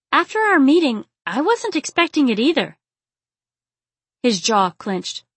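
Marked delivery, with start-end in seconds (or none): 0.1-2.8 high, emphatic protest; agitated heroine. A narrator's reading. Aziza defending herself to Brandon after the meeting, agitated.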